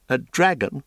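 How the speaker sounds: a mild, half-hearted scoff; lightly mock the idea, unconvinced; trying to scoff. A narrator's reading. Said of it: "trying to scoff".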